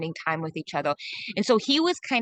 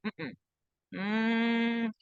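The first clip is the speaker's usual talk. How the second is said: a soft closed hum of no; quiet negative hum; refusing mm-mm; 2 semitones higher, 5 dB quieter than usual. Shown as a demonstration. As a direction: hum a gut no. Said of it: "when something's not for them".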